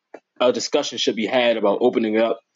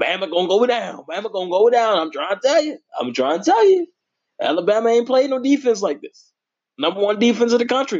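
hyped up; 7 semitones higher than usual. Real, not acted. Hyping Michigan to the audience, high excited voice. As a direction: voice climbing higher and higher with excitement.

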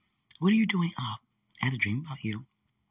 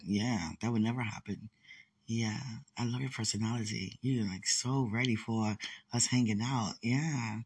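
ordinary talk, nothing marked

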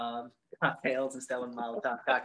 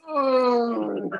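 high, loud mock groan of misery; hammed-up groan; 10 semitones higher, 9 dB louder than usual. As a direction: groan like a wrecked man in a tub. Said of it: "Just like".

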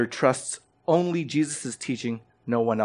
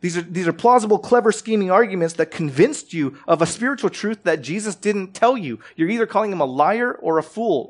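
raised, louder, higher preaching voice; 6 semitones higher, 5 dB louder than usual. Acted. Preacher pressing the congregation on Scripture, fervent. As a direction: build to full pulpit force.

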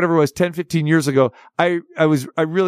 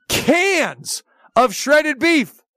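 incredulous reveal; 11 semitones higher, slower than usual. Retold or imitated. Revealing the Brazilian cans.

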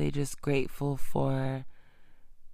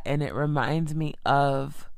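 ordinary delivery

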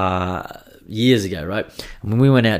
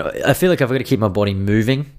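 ordinary talk

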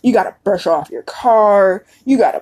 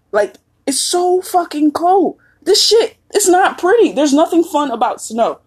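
exasperated rant; 8 semitones higher than usual. Real, not acted. Ranting about cold.

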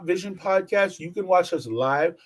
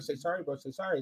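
urgent hush; 5 semitones lower, 12 dB quieter, faster than usual. Retold or imitated. Friend begging him to apologize.

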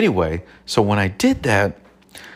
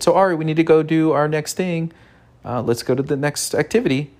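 playing the teacher; 8 semitones higher than usual. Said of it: "I said".

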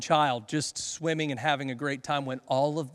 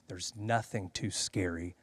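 usual speaking voice

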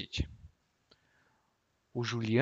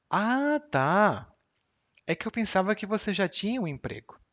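ironic reply; 7 semitones higher than usual. Shown as a demonstration. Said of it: "irônica".

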